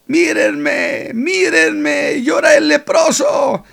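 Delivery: a high, loud exultant cry; joyful shout. Acted. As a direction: shout it so everyone hears. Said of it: "¿por qué ahora no voy a gritar gozoso?". (el leproso curado grita de alegría a todos)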